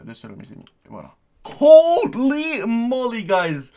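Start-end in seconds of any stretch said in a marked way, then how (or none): 1.6-3.7 slow, high, loud drawn-out cry; excited shout. Real, not acted. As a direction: belt it out in delight. Excited reaction to donation.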